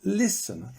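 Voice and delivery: LIS-ten, high on LIS then falling; listen with a high, strong first beat; stress on first syllable of listen. Shown as a demonstration. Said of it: "but it's here".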